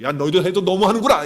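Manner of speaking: acted reproachful telling-off; mock scolding. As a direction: tell them off in mild exasperation. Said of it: "야단치지 않으시고".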